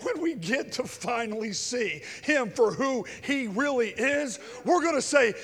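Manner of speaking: loud, high, building preacher's cry; climbing revival preaching voice; fiery preaching. Real, not acted. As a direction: preach it out at full force. Preaching at full force.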